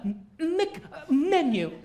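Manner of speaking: mock stammering over Mc; comic stutter block on the Mc prefix. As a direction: repeat the m sound, stuck on it. Mimics stuttering on Mc menu.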